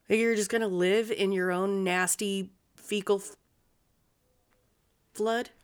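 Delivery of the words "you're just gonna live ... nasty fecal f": ordinary talk, nothing marked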